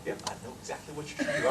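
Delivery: hushed, quick near-whisper; low, breath-only confiding voice; secretive knowing. Retold or imitated. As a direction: imitate her whispering a great secret. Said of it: "She goes like this".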